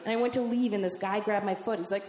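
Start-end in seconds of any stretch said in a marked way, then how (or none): none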